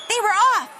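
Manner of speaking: excited narration. A narrator's reading. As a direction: race is off, narrator thrilled. Race begins.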